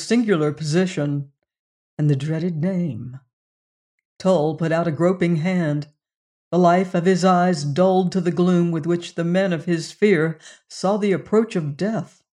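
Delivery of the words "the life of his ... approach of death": usual speaking voice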